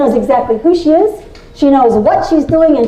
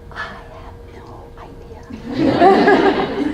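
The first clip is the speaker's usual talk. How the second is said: laughing whisper; 7 semitones higher, 5 dB quieter, much slower than usual. Real, not acted. Laughing self-deprecating aside.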